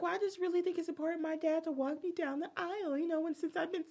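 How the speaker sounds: high, fast whining; whiny girlfriend mock. Girlfriend insisting dad walk her, high whiny mock. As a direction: pile it on in a high pleading rush. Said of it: "She's like".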